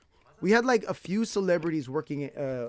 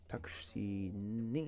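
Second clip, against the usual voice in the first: "Taxi ni" said very slowly, syllable by syllable, lower and softer; slow teaching demo; 8 semitones lower, 9 dB quieter, much slower than usual. Shown as a demonstration. Teacher modelling "Taxi ni" for a student, very slow. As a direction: draw out "Taxi ni" slowly so the learner can copy it. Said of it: "S1 repeats "Ni".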